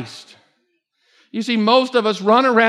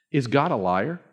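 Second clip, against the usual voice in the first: low, soft question; first quiet asking; 9 semitones lower, 7 dB quieter than usual. Shown as a demonstration. Preacher first puts the question to the congregation, quietly. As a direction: pose it softly, almost to yourself. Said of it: "let me hear everybody".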